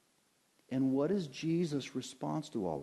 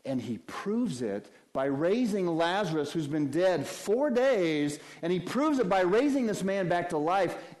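normal talking voice

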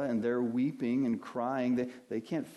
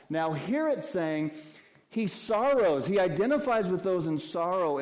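higher and louder, building fervor; preaching with mounting intensity; rising preacher; 7 semitones higher, 5 dB louder than usual. Acted. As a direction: lift the congregation. Preaching builds to full force.

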